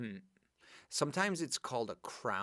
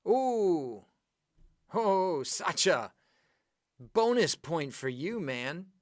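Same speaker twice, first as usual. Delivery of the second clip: excited praise; 7 semitones higher, 10 dB louder, slower than usual. Real, not acted. Teacher praising a correct answer.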